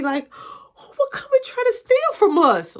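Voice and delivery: high, loud crying wail; a mother grieving her stolen car; mom near tears. Retold or imitated. Mom upset at table.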